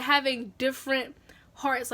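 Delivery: ordinary delivery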